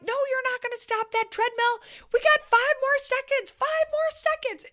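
very high yelling refusal; mock yelling. Coach to fallen friend, high yelled refusal. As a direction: refuse her like a ruthless drill sergeant. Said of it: "And I'm yelling".

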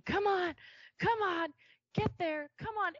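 high, loud coaching yell; husband yelling come on at the rider; retold yelling. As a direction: push her on from the rail. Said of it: "And he's yelling".

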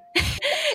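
high, bright laugh; burst of laughter. Real, not acted. Host laughing, amazed by the exercise. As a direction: let a surprised laugh burst out.

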